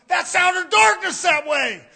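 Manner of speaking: loud, high sermon shout; fired-up pulpit delivery; full-force preaching. Acted. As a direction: preach it out at the top of your voice.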